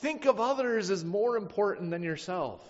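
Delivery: a lightly voiced Paul laying down the law; firm rebuke. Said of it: "Paul has to come down and say".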